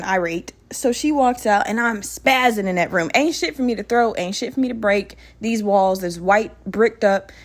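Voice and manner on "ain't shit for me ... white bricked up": normal talking voice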